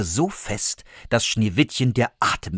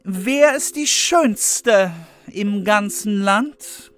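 vain queen's incantation; 12 semitones higher, slower than usual. A narrator's reading. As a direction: chant to the mirror grandly. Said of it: "und fragte".

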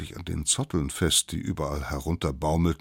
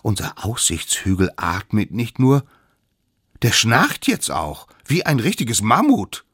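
louder, raised, emphatic report; astonished little savages; 6 semitones higher, 8 dB louder than usual. Acted. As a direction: point out the startling discovery. Others report snoring in alarm. (staunend, laut und betont)

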